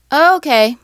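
flat disinterested. Retold or imitated. Imitating someone who heard Ohio, uninterested. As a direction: shrug it off. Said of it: "you just sit there like".

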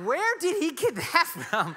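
high, slow, baffled; can't believe what the kid said; amazed question. Retold or imitated. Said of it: "And I'm like".

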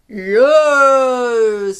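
loud, slow, drawn-out boom; booming dinosaur. Acted. Reader voicing huge stomping dinosaur answering Pipkin. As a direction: boom like a giant stomping beast. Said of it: "said the dinosaur".

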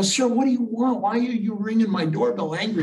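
lightly acted annoyance at a caller; irritated homeowner. Homeowner challenges stranger ringing bell.

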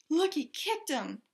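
usual speaking voice

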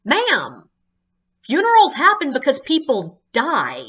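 exasperated firmness; stern patient correction. Retold or imitated. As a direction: lay it out like to a child. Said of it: "And I'm like".

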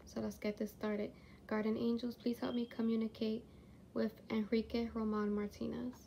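hushed. Real, not acted. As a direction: speak quietly, as if not to disturb. Hushed spirit box session start.

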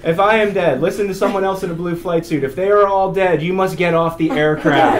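loud, high, commanding announcement; deadpan crash orders projected over the cabin; imitating a blunt loadmaster; flight crew briefing. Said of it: "He says".

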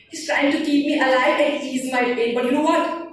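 high, loud, desperate verse; urgent poem recitation. Acted. Dying soldier watching the medic work, urgent declaiming. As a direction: declaim urgently, pitch climbing to the question. Poem's medic fights for him.

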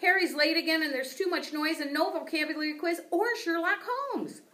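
high, loud upset squeak; squeaky hamster rant. A narrator's reading. Hamster complaining to Og about the class, high upset squeak. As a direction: pour out the worries in a tiny high voice.

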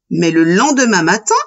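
usual speaking voice

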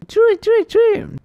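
tiny, very high squeaky mouse voice; mouse squeak. Acted. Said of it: "El ratón le responde".

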